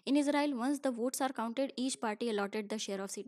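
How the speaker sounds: slow, deliberate textbook reading; slow reading aloud. Acted. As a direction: read each English word out slowly for the class.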